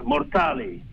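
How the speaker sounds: usual speaking voice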